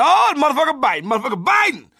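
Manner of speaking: high, loud, fast yelp; high yelping shout, hammed-up; pained yell. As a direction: yell out in pain at the bite. Yelling as wife bites him.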